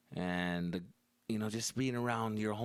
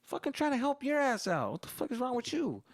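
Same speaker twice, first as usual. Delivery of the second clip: much higher, indignant son voice; outraged son; 13 semitones higher than usual. Retold or imitated. Son protesting to his dad, high and indignant. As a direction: protest being sent away. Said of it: "I'm like".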